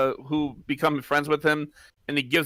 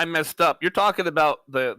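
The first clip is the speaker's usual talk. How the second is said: ordinary talk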